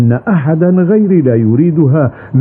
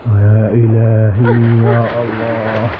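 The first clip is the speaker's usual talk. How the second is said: slow, fading plea to God; terrified old man calls on God; frightened prayer; 2 semitones lower, slower than usual. Acted. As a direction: draw out يا الله in dread. Crocodile attack, old man prays.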